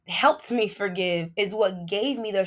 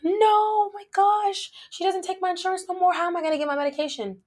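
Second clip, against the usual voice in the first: high, fast panicked cry of no no; 10 semitones higher, 4 dB louder than usual. Retold or imitated. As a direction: voice shooting up in alarm at losing the meds. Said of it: "And I was like".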